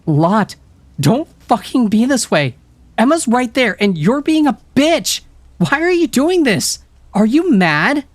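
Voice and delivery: high, loud angry scolding; furious. A narrator's reading. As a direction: tell her off hard. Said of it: "Summer was furious".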